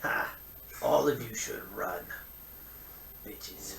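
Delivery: a lightly voiced mocking old tree; talking tree. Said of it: "The tree says".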